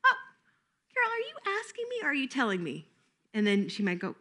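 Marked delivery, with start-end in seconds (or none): 0.1-2.9 much higher, softer sing-song query; playful teacher question. Shown as a demonstration. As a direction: tease the child gently into asking.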